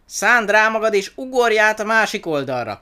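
high, very loud command; desperate high-pitched urging; urgent shout. A narrator's reading. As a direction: shout at them to jump now. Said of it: "kiáltott Twiga".

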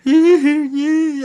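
put-on a high, loud baby-like "ay" cry; imitating a grown woman crying like a baby; baby whimper. Retelling the woman on the floor, imitated baby whimper. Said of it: "empieza a llorar como un bebé".

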